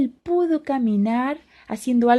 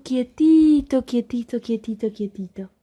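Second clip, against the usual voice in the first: fading to a whisper; 2 semitones higher than usual. Acted. Calm sea, fading repetition.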